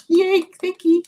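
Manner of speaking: high and loud, gushing thanks; excited thank you. Retold or imitated. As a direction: squeal it like a thrilled shopper. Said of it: "I'm like".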